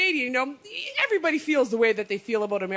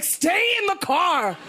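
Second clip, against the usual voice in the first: urgent plea; 5 semitones higher, 3 dB louder, much slower than usual. Retold or imitated. Pleading to Obama on TV.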